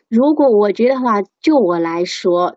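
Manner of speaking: ordinary talk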